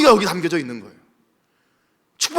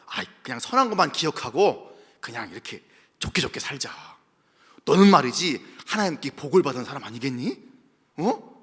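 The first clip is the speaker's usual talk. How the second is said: soft low coaxing plea; hushed pleading; 7 semitones lower, 6 dB quieter than usual. Acted. Enemies coaxing Isaac, soft and low. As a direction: coax him gently, voice kept low.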